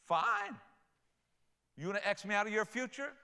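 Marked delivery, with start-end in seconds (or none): 0.0-0.6 "Fine" jumped far higher, then falling; God's sharp "Fine". Retold or imitated. God letting a stubborn person go, much higher and exasperated. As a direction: fling "Fine" up high, exasperated. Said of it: "God will say".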